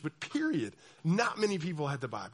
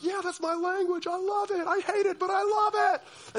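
much higher, giddy fan voice; gushing Covenant kid; 13 semitones higher, 3 dB louder than usual. Retold or imitated. Pastor imitating Covenant student loving Latin, high giddy. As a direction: squeal it like a devoted fan. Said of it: "you're like".

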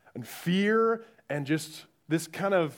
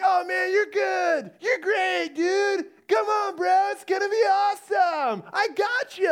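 high-pitched, over-encouraging cheerleader bro; drawn-out, high-pitched hype voice; super-nice guy; 12 semitones higher, 3 dB louder than usual. Retold or imitated. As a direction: play a relentlessly upbeat helper calling up from below. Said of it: "he's so nice".

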